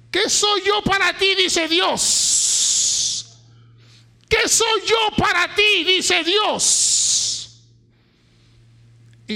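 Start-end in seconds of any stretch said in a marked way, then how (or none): 0.1-7.6 loud, higher, slow divine challenge; God demanding an answer; booming question. Acted. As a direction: call it out as God asking. Voicing God's question loudly.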